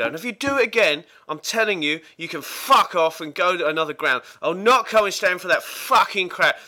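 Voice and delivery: building mock rage, swearing hard at reporters; imitating Kinnear's rant. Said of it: "the transcript goes something like".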